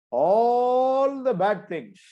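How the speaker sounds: all the bad things drawn out high and loud; preacher's stretched emphasis; slow emphasis. Real, not acted. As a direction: lean hard on all the bad things, very slowly. Emphatic summing up.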